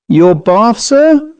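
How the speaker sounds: "bath" stressed in a high rising question; incredulous echo stressing "bath"; stressed astonished query. A narrator's reading. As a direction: lean on "bath" in disbelief, "sir" lifting very high. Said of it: "Gosling stressed the word".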